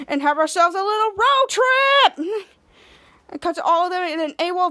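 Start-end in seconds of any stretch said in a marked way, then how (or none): 0.1-2.5 slow build to a high cheer; rallying pitch. Retold or imitated. Captain pitching the trip to his team, building excitement. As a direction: stretch it out, climbing excitedly.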